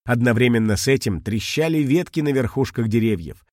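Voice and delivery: usual speaking voice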